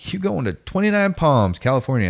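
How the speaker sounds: high-pitched imitated instructor call; announcing orders. Instructor handing a recruit his orders, calling out. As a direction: call out the posting.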